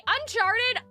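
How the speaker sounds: ordinary talk, nothing marked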